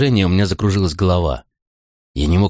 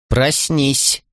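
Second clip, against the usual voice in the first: higher-pitched firm order; firm command; 7 semitones higher than usual. A narrator's reading. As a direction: order a sleeper awake firmly. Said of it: "твёрдо приказала она". (твёрдый приказ)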